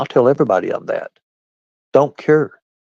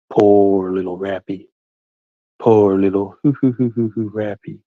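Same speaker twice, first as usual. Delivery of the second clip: mock pity. Mocking a jailed rival.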